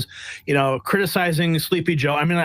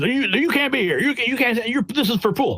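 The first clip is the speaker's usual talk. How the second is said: higher, huffy press-guy voice; mock scolding; 7 semitones higher than usual. Press guys telling the reporter he can't stand there, mock huffy. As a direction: mimic the officious press corps. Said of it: "These press guys".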